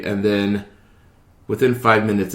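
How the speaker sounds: ordinary talk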